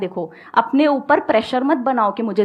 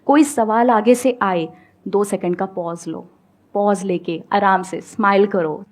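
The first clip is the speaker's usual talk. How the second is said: ordinary talk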